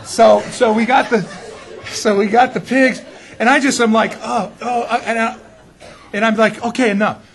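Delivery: can barely get the words out for laughing; giggling retelling. Real, not acted. Laughing at own pig story.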